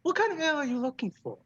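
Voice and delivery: high, hammed-up Irish granny voice; Irish Mrs. Doubtfire. Dwarf bartender welcoming the musician, terrible Irish accent. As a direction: play a badly faked Irish Mrs. Doubtfire. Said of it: "Irish Miss Doubtfire".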